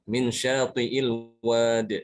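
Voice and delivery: very slow chant, wad ending on bounced d; stopped without ya. Shown as a demonstration. Teacher showing the pause form, chanted slowly. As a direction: stretch wad and drop the i. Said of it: "tanpa ya".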